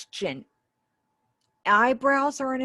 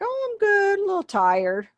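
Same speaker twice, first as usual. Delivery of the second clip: high, louder sing-out reply; person A replying; 13 semitones higher, 5 dB louder than usual. Acted. Teacher voicing person A in a sample dialogue, high acted reply. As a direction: lift it high as a role-play answer. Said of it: "person A would say something like".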